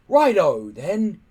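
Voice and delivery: loud, high hearty chief's call. Acted. Stoic the chief eagerly calling to open the chest, loud and hearty. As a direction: boom it out, rubbing your hands.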